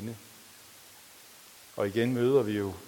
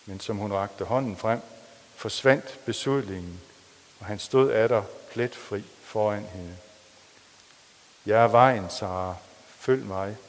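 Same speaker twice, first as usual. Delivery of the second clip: lively, louder novel reading; story reading; 6 dB louder than usual. A narrator's reading. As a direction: read the story aloud with drama. Reads a novel aloud in sermon. (dramatisk højtlæsning af en roman)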